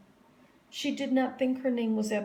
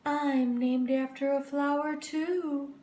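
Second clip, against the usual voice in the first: teacher; 6 semitones higher than usual. A narrator's reading. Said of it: "said Missus Twinkle".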